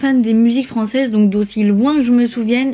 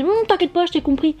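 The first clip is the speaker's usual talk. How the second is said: imitating her family; 7 semitones higher than usual. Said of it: "Et là, ils me disent".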